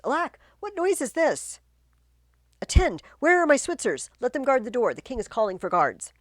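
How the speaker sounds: a high, loud call for the guards; startled, shouting for help; alarmed king. Acted.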